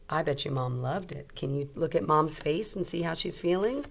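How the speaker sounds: usual speaking voice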